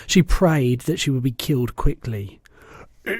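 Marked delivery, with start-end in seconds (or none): 3.1-3.2 a giant's pained roar. A narrator's reading. Bloodbottler bellowing as he bites Sophie. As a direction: bellow it out in pain. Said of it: "roared the blood bottler".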